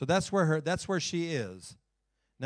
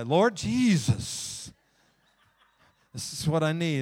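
rising prayer; 3 semitones higher, 7 dB louder, slower than usual. Acted. Another year goes by.

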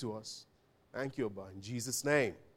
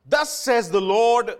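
proclaiming; 4 semitones higher, 8 dB louder, slower than usual. Acted. Preacher proclaiming God's word.

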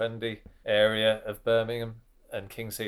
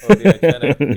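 much louder and higher, savouring the word; delighted repeat of a new word; excited echo; 7 semitones higher, 15 dB louder than usual. Real, not acted. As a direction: proclaim it like a slogan.

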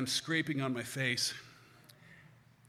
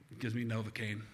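plain reading voice

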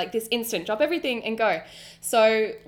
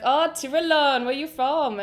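high, loud attempt at Jamaican; bad Jamaican accent; 6 semitones higher, 5 dB louder than usual. Talking head asks visitor her origin, bad Jamaican accent. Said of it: "bad accent".

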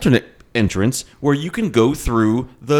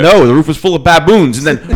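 ordinary talk, nothing marked

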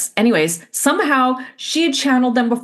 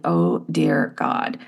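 low, slow sinking realization; retold dismay; 11 semitones lower, much slower than usual. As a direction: drop low and draw it out in dismay. Reacts as the light goes on.

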